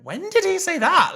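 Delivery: very high, loud bafflement; exaggerated disbelief. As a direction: squawk it in mock confusion. Acting own confusion while watching.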